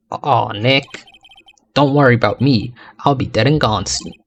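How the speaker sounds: normal talking voice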